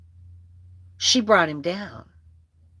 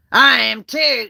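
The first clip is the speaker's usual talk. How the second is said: defiant; 4 semitones higher, 7 dB louder than usual. Retold or imitated. Acted quarrel, cousin's defiant retort.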